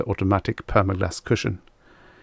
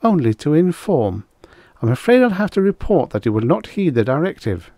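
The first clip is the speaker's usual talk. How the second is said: icy; 7 semitones higher, 4 dB louder than usual. Acted. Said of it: "said icily".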